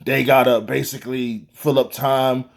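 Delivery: low mocking imitation of the excuse-makers. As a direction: brush off the excuse in a dismissive low voice. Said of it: "I don't wanna hear".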